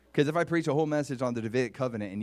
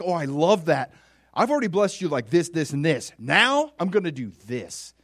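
loud, higher, pleased response; delighted God; 4 semitones higher, 8 dB louder than usual. Acted. God answering David's offer, loudly delighted. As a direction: answer with generous delight, punching each gift. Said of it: "God responds like".

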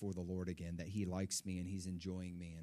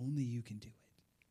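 whispered prayer. Real, not acted. Preacher praying, whispering the line again to God. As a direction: whisper it, no voice at all.

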